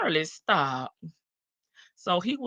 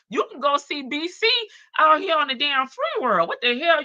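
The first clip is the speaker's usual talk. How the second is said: ordinary talk, nothing marked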